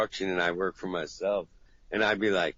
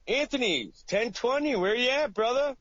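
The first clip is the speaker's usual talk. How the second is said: much higher shrill-pitched call; angry caller voice; 13 semitones higher than usual. Retold or imitated. Client's escalating voicemail calling him brother, then jive turkey. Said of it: "he's like".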